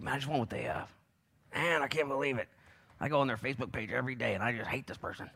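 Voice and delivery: soft, sulky put-on grumble; mock envious muttering. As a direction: mutter it like a jealous onlooker. Acts out an envious person's muttering.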